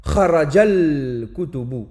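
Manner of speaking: kharajal kutubu lié d'un trait, lentement, plus fort; liaison kharajal kutubu. Shown as a demonstration. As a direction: lier la dernière lettre au lam dans kharajal kutubu. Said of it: "On dira par exemple". (liaison lente et appuyée)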